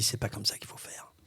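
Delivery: soft, voiceless warning; whispered inner voice. Acted. Inner voice correcting the actor, whispered. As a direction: whisper it like a thought in your head. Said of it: "j'ai ma voix intérieure qui me dit".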